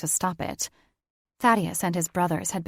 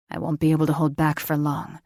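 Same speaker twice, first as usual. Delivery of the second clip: male lover voice; 3 semitones lower, 4 dB louder, faster than usual. Acted. Lover speaks, lower male voice.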